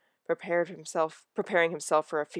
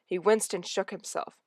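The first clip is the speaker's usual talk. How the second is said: usual speaking voice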